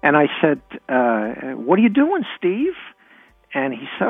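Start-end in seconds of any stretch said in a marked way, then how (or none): 1.5-2.7 lightly voiced little kid, high and curious; child's question. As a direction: pitch it up like a kid asking big brother. Child self asks brother, retold.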